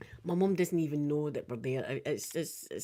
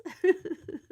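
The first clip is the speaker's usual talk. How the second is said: high giggle; high, delighted laughter; 11 semitones higher, 4 dB louder than usual. Real, not acted. Overcome talking about her dog.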